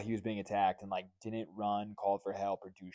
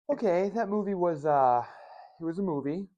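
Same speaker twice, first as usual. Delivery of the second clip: wry disappointment; 9 semitones higher, 6 dB louder than usual. Real, not acted. Verdict on a bad movie.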